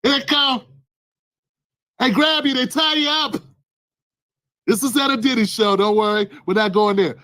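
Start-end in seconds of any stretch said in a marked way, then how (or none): none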